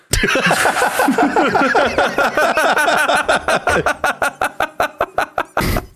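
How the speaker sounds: high-pitched rolling laugh; long laugh. Real, not acted. Friend joking about a dingo eating the dog, long high laugh.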